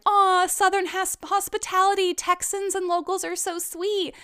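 high, sugary mock praise; mock gushing. Show's spiel about sweet Texans, high and gushing. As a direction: gush over Southern hospitality. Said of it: "the whole spiel is like".

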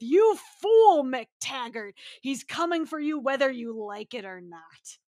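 booming stage-actor cry, then commanding warning; theatrical professor voice. Acted. Professor warning McTaggart he's coming for him, theatrical voice. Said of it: "Theater actors got those voices".

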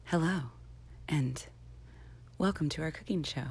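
soft, understated radio host voice; lightly voiced nerdy radio host; a hint of a mild public radio hostess. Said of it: "they're both really nerdy and they're like".

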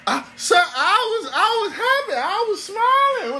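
very high, stammering protest; pleading slave. Acted. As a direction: plead meekly with the master in a hammed-up high voice.

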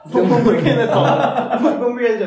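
real laughter bursting into loud speech; laughing punchline. Real, not acted. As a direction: crack up while delivering the punchline. Cracking up at burger slap story.

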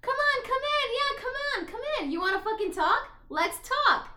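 high, loud, goading mock shout; acted angry challenge. Retelling Massimo daring the ex to talk, hammed-up anger. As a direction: pitch it way up, egging them on.

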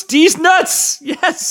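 high, triumphant cry; excited exclamation. Real, not acted. Co-host landing the missed joke, excited exclamation. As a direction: crow the joke with delight.

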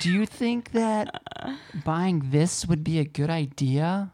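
slow, put-on wheedling; mock partner voice. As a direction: imitate a partner gingerly asking permission. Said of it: "That is not how I talk at all".

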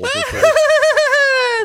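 sudden high cackling maniac laugh; maniacal laugh. Acted. Channelling a child on the podcast, maniacal laugh. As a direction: burst into a crazy cackle out of nowhere. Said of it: "let me channel my child".

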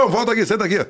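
high, urgent retold command; calling back. Director stopping the actor from walking out, insistent call. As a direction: imitate a boss summoning someone back.

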